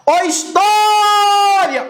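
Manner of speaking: high, slow call on história; long drawn-out call. Shown as a demonstration. Teacher hollering an example word to the class, stretched call. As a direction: holler história, holding the stressed vowel.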